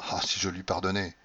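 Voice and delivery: plain reading voice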